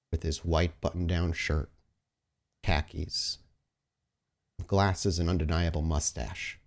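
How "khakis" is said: normal talking voice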